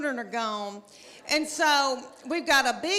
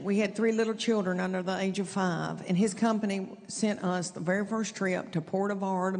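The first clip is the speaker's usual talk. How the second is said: ordinary talk, nothing marked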